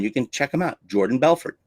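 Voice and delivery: usual speaking voice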